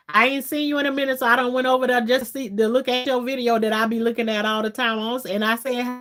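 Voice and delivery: ordinary delivery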